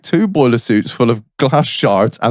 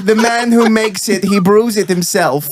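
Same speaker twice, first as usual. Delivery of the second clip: mock rabbi; 5 semitones higher than usual. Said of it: "Rabbi Reg here".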